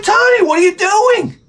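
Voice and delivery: very high, outraged shriek; high-pitched frantic scolding; appalled father's yell. Retold or imitated. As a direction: act out yelling at your kid in disbelief. Acted father scolding his son.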